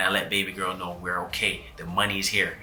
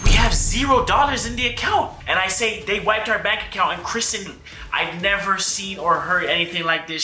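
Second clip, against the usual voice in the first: upset telling, pitched up high; alarmed; 9 semitones higher than usual. Real, not acted. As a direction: voice jumps up in shock at the news. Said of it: "So at this moment".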